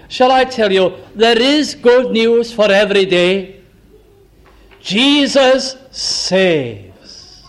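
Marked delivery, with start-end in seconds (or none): 4.8-7.4 each word drawn out, solemn and full; slow proclamation. Acted. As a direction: proclaim it slowly like a banner headline. Slow proclaimed gospel line.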